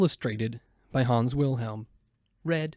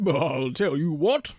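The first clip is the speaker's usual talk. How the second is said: slow, knowing donkey; an old donkey with an idea; slower than usual. Acted. Donkey offers the dog a plan, slow and knowing.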